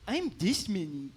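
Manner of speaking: put-on tiny high-pitched child voice, slow; five-year-old girl. Cute five-year-old answering the stadium host, small slow child voice. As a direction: imitate a cute little kid. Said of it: "cute little five-year-old girl".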